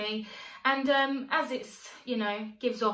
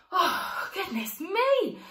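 usual speaking voice

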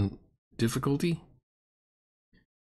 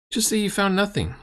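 exasperated; 11 semitones higher than usual. Real, not acted. Exasperated at GM's phrasing.

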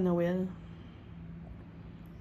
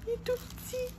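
high, soft squeaky coo over a tiny thing; 10 dB quieter, faster than usual. Real, not acted. Gushing over the tiny surprise tray, high hushed squeak. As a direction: squeal it under your breath, way up high.

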